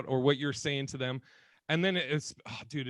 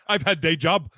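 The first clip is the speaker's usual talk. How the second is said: louder, blunt caveman grunt; mock caveman speaking broken English; 3 semitones higher, 8 dB louder than usual. Shown as a demonstration. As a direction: say it like a proud caveman. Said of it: "talking like a caveman".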